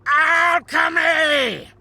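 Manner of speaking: ALCHEMY yelled high and drawn out; indignant shout. Acted. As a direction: shriek "Alchemy" slowly, outraged at the slight. Indignant repeat of the correction.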